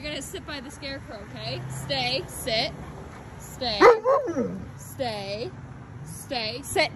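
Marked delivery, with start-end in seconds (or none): none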